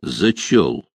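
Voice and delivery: low, curt grumble; grumbled. A narrator's reading. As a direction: grunt the answer grudgingly. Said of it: "буркнул штурман".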